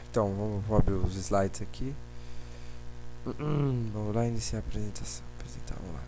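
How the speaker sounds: hushed muttering to himself while opening slides; whispered aside. Real, not acted. Teacher whispering to himself while starting the slides, hushed.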